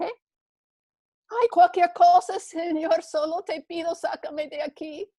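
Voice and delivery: high voice begging to be saved; man on the branch, pleading; desperate pleading. Acted. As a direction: beg in panic. Jack begging again.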